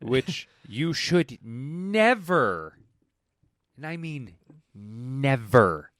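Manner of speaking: never drawn out slow and pointed; stress on never. Real, not acted. Defending Wonder Woman, never stretched and stressed. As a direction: hammer the word never.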